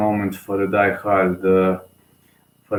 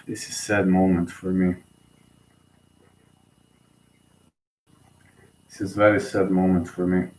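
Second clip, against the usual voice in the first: real sadness; 3 semitones lower, 4 dB quieter than usual. Real, not acted. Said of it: "I'm gonna start crying".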